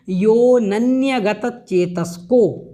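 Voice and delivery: यो नन्यगत चेतस्को, with नन्यगत starting on n and no a after यो; slow model pronunciation. Shown as a demonstration. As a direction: draw out यो नन्यगत चेतस्को very slowly. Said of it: "यहाँ पर ध्यान रखें".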